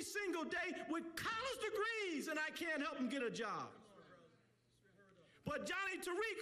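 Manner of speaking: impassioned community preacher; fired-up speech from the pulpit; preaching. Real, not acted. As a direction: drive each point home to the crowd in rolling phrases.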